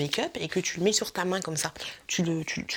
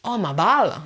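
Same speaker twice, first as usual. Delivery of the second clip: louder mock English-Québécois accent; 2 semitones higher, 6 dB louder than usual. Vlogger gushing over a bath bomb, put-on English accent. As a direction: exclaim it in a muddled English accent. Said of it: "quand je fais l'accent anglais".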